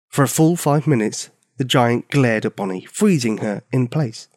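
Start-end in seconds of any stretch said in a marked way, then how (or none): none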